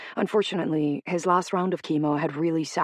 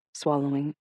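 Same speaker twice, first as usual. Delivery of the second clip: ordinary delivery, nothing marked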